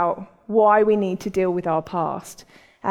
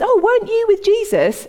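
high-pitched imitated questioner; servant girl; 12 semitones higher, 4 dB louder than usual. Servant girl confronting Peter, high and inquisitive. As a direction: act a girl's pointed question, pitched well up. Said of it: "this servant girl comes up to Peter".

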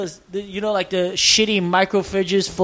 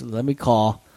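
low, matter-of-fact retold voice; low-voiced employee quote; 10 semitones lower than usual. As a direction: quote him in a lower register. Quoting gym employee in story.

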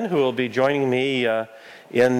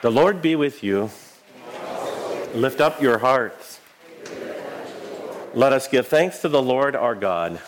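solemn call to the congregation; liturgical call. Real, not acted. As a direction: intone it like a pastor.

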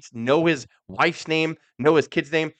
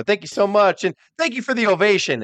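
sarcastic thanks; 7 semitones higher, 4 dB louder than usual. Retold or imitated. Honky mock-thanking booing fans.

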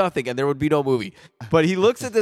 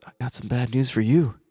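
low, steady tough-guy voice; a hint of a cold killer; lightly voiced Seagal; 5 semitones lower than usual. Friend acting out Seagal telling Chen he is a killer, in a low voice. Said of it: "Steven goes".

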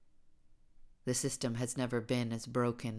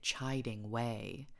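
plain reading voice

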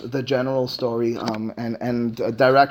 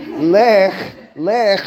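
louder, higher, emphatic order; command; 5 semitones higher, 6 dB louder than usual. Acted. Esther sends her order back to Mordechai, commanding. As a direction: order it out firmly. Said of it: "That's a command".

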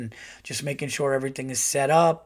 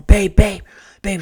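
loud urgent calling of babe; 6 semitones higher, 13 dB louder, slower than usual. Retold or imitated. Husband loudly waking wife over escaped hamster, urgent call. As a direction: call out to wake her, alarmed.

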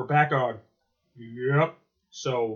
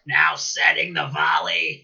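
Zorak announcer voice; slower than usual. Retold or imitated. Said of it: "do it as Zorak".

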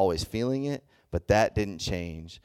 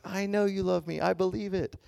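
wife voice; 12 semitones higher than usual. Retold or imitated. Wife's tearful reply in story.

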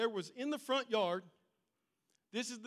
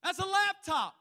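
scolding; sharp disbelieving scold; 11 semitones higher, 8 dB louder than usual. Acted. Preacher playing a scolding friend, high and loud.

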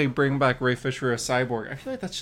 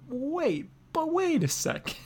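sudden surprise; 14 semitones higher than usual. Real, not acted. Suddenly spots something on screen.

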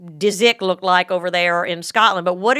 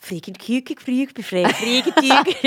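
mock Swedish; 3 semitones higher, much slower than usual. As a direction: fake Swedish gibberish syllables. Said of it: "it does sound sexier when you have, like, that".

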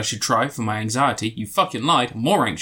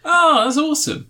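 mock enthusiasm; 4 dB louder than usual. Real, not acted.

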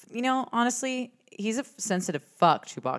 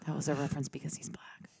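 low hushed whisper; 5 semitones lower, 11 dB quieter than usual. Real, not acted. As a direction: murmur it behind a hand. Whispered aside explaining joke.

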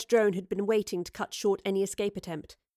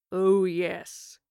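menacing growl, drawn out; low growl. A narrator's reading. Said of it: "came a low growl behind her".

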